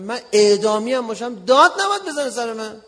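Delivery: retold indignant outburst; indignant protest. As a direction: protest loudly as the wronged party. Retold protest to senior officer.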